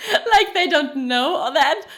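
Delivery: loud, high, laughing disbelief. Real, not acted. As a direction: crack up while mocking it, really laughing. Laughing at the clumsy exposition.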